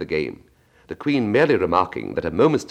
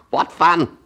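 much higher, louder gleeful griffin; 10 semitones higher, 7 dB louder than usual. Acted. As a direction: relish the joke. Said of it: "said the griffin".

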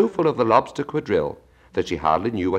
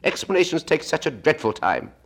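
high Gryphon voice; 11 semitones higher than usual. Acted.

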